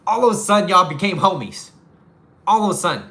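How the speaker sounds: loud delighted all of a sudden; amused exclaim. Real, not acted. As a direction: burst out with the payoff. Punchline after the nice-driver demo.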